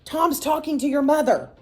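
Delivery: urgent yell. A narrator's reading. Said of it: "yelled Pete".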